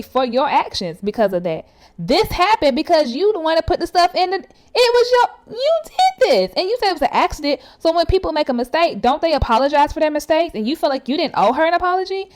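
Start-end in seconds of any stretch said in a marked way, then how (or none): 2.0-12.3 high-pitched, indignant telling-off; exasperated scolding. Real, not acted. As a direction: confront someone who won't own the blame, pitch climbing high. Heatedly scolding Amir in absentia.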